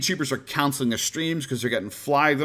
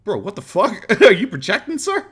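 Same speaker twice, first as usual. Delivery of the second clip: incredulous retort; 9 semitones higher, 6 dB louder than usual. Retold or imitated. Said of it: "It's like".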